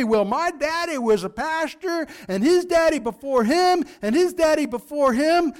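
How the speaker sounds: Southern Baptist preacher impression. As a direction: do a Southern Baptist preacher bragging about his lineage. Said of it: "this voice goes off better in a Southern Baptist church".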